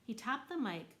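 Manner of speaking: ordinary delivery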